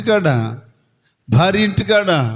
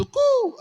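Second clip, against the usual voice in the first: high hooting coo, like hide and seek; playful high-pitched hiding call; 5 dB quieter than usual. Retold or imitated. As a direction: call out a sharp high koo. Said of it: "అంటారు".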